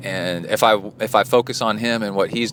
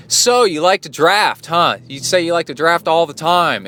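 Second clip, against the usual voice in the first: higher, louder mocking challenge; sarcastic confrontation, raised voice; challenging call-out; 7 semitones higher, 4 dB louder than usual. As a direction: needle him about his drafting. Confronting the drafter.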